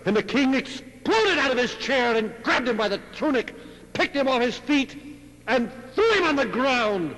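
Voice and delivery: preacher bursting into the king's outburst; explosive narration. A narrator's reading.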